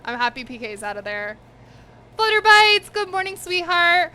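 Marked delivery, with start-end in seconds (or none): 2.2-4.1 pet talk. Real, not acted. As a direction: coo at the pet in a high voice. Greeting a pet sweetly.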